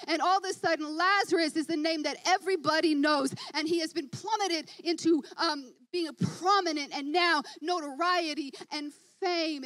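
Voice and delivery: high, loud, driving sermon voice; rising excited preacher building the story; full-force preaching. Acted. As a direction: preach it up to a roar.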